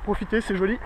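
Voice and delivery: usual speaking voice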